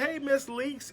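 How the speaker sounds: high sing-song hello right back; high mock greeting. Cynthia greeting Nene back, high sugary voice. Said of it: "Cynthia turns around and says".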